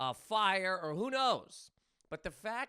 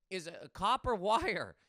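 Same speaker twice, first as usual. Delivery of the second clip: normal talking voice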